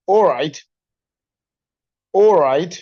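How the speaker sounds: ऑलराइट said as oraight, no l sound; ऑलराइट with a plain o at the start; o right reduction. Shown as a demonstration. Teacher repeats ऑलराइट as oraight. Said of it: "ए एल दोनों की sound replace हो जाएगी ओ के साथ".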